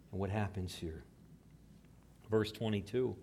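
ordinary delivery

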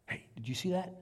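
hushed, tentative question; hushed startled question. Acted. Disciple to his mates spotting a dark shape, hushed.

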